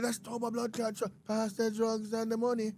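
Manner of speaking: high, held monotone quote; re-enacted reaction. Acted reaction, high level monotone.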